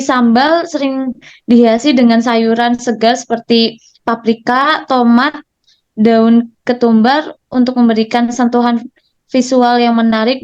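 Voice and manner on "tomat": normal talking voice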